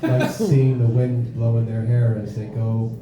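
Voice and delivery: a hint of a low-voiced lurker musing; playground creep.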